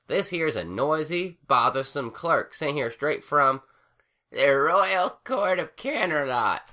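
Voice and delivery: sneering country mare; accusing farm mare. A narrator's reading. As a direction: call out the intruder. Applejack figure voice.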